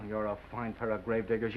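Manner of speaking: normal talking voice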